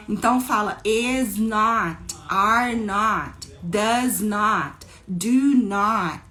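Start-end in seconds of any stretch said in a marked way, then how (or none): 0.8-2.1 "is not" in two separate slow words; unhurried, emphatic "is not", uncontracted; full form "is not". Shown as a demonstration. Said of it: "eu coloco a palavra not". 2.3-6.2 "are not, does not, do not" word by word; each "not" said in full; teacher's measured, separate "not" after each verb; full uncontracted "not" forms. Shown as a demonstration. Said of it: "eu coloco a palavra not".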